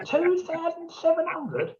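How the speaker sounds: high, slow stunned echo of the price; a slow, incredulous high-pitched question; shocked disbelief. Retold or imitated. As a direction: repeat it back, aghast at the cost.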